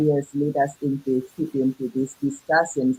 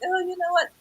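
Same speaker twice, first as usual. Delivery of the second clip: high, excited exclamation, much higher; surprised realization; 13 semitones higher, faster than usual. Retold or imitated. Telling her sweetheart about the taxes, high and excited. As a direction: pitch it up with sudden discovery.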